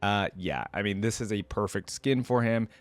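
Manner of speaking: ordinary delivery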